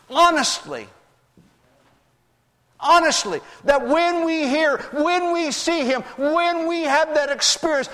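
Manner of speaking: high-pitched, driving sermon delivery; impassioned preaching. Real, not acted. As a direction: preach it with rising fervour and stretched key words. Preaches at full force.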